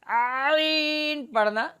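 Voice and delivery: عین squeezed and pressed in the mid-throat, slow; wrong strained ain. Shown as a demonstration. Teacher showing students the pressed wrong عین. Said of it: "وسط حلق میں آواز دبا کر".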